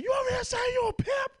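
shrill mock mother shouting at her son; angry mother yelling. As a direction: yell it like mom catching him.

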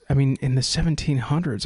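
normal talking voice

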